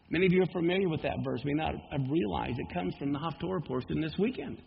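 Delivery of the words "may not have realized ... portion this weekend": usual speaking voice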